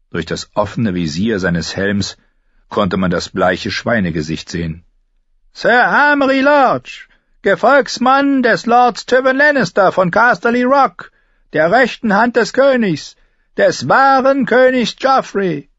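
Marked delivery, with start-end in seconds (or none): 5.5-15.7 loud, very high pompous knight; high-pitched herald-like proclamation; high, thin voice. A narrator's reading. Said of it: "Seine Stimme war hoch und dünn".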